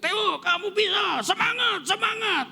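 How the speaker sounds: loud, high, drawn-out yelling; cheering shout. Retold or imitated. Father urges Theo on at his final, cheering shout. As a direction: re-enact a parent screaming encouragement from the stands. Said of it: "saya teriak-teriak".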